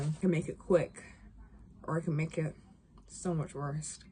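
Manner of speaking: lightly acted cold threat, hushed and sinking; a soft, understated attempt at a menacing line; Ellie. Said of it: "this is me and Ellie".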